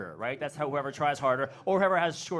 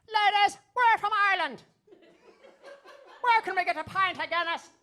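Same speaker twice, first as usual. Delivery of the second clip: very high, loud mock Irish accent; hammed-up squealing Irish accent, showing it off; perfect Irish accent; 4 dB louder than usual. Two buddies posing as Irish to ladies, over-the-top Irish accent. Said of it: "with my perfect Irish accent".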